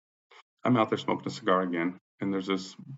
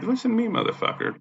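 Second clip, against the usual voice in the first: very high, exasperated demand; retold angry warning. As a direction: replay demanding her attention, pitched way up. Retelling his angry warning.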